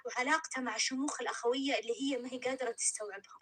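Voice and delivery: plain reading voice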